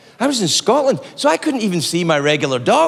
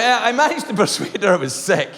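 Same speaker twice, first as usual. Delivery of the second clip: normal talking voice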